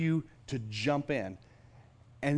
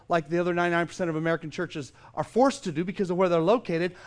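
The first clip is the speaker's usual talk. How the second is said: normal talking voice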